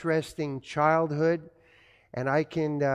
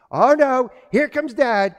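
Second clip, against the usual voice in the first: much louder and higher alarmed cry; mock childhood panic; 8 semitones higher, 10 dB louder than usual. Preacher as a little kid seeing dad's forearms, mock panic. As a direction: yelp in mock fright at dad's arrival. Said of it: "I'm like".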